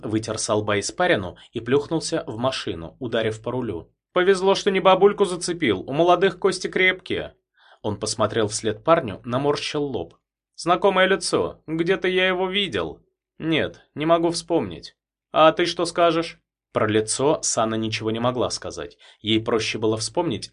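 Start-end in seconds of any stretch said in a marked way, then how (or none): 4.1-7.3 captain's voice. A narrator's reading. Captain relieved. 10.6-16.4 captain's voice. A narrator's reading. Captain musing.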